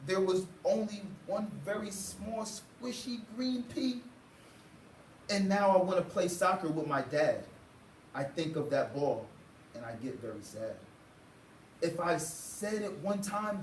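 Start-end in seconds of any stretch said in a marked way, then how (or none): none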